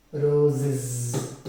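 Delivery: ROSES ending in a drawn-out buzzing "iz"; roses, voiced z at the end; pronunciation demo. Shown as a demonstration. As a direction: say roses carefully, holding the final z. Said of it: "How, how are you going to write?".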